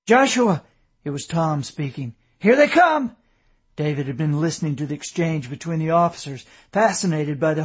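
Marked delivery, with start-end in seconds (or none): 0.1-0.6 higher, louder call; urgent call. A narrator's reading. Tom runs back to tell his brother, urgent call. 2.4-3.2 high, loud alarm; alarmed warning. A narrator's reading. Tom warns his brother the enemy is coming, loud alarm.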